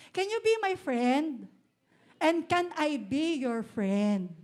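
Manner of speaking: slow, warm line for the crowd to repeat; slow prompt line. Shown as a demonstration. Pastor models a line for the congregation to tell their neighbour, slow. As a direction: spell it out slowly so they can echo it. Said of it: "Sabihin mo sa katabi mo".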